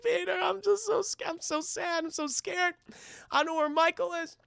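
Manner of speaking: high, soft tearful whimper; mock sobbing. Retelling Felicia sobbing to Peter, mock tearful. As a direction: whimper pitifully, hammed-up and small. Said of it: "she's just fucking sobbing".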